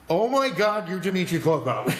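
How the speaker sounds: high, excited gasp of recognition; fanboy spotting his idol in the gym; starstruck. Retold or imitated. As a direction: squeal it like meeting your hero. Starstruck retold reaction.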